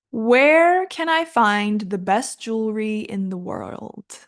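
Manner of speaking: slow, carefully enunciated model question; practice sentence. Shown as a demonstration. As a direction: lay the sentence out slowly for learners. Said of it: "let's practice".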